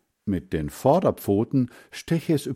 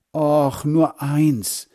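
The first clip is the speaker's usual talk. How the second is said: higher, louder wheedling plea; begging; 6 semitones higher, 5 dB louder, much slower than usual. A narrator's reading. Margret to her brother for a rabbit, begging. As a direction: beg like a little sister. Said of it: "bettelte Margret".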